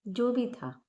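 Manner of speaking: calm, unhurried meditation guide with long pauses; measured, soothing guidance, drawing out the breathing cues; guided meditation. Acted. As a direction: guide them gently inward, phrase by phrase.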